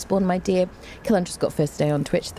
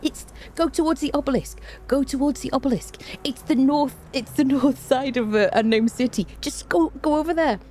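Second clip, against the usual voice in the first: high-pitched imagined urging; mock urgent backseating; 8 semitones higher than usual. Lurker silently directing a co-streamer, high urgent voice. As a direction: plead in a high voice, as if itching to give directions. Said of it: "I didn't say anything. I was like".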